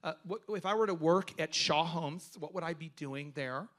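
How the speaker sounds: softly imitating a candidate who enunciates the S's; imitating candidate's voice. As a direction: lightly mimic his careful S's. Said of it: "who he talked like this".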